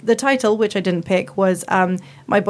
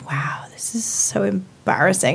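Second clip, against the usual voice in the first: mock whisper. Everyone reacting to awkward teen dialogue.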